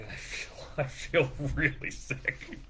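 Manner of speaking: slow, soft admission broken by a laugh; sheepish half-laughed groan of a confession; queasy, laughing confession. Real, not acted. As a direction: confess it weakly through a laugh. Queasy confession after eating marshmallows.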